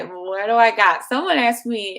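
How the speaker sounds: ordinary delivery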